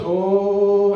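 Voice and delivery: vacant droning mumble; scared, lost tourist. Retold or imitated. Tourist scared of the world wandering in, dazed drone. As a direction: drone like a bewildered robot vacuum. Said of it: "they're just scared of the world".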